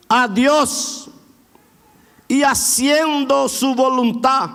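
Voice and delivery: measured, deliberate reading of the verse; slow scripture reading. Acted. Pastor reading Acts to the church, slow and deliberate. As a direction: read each word out to the congregation.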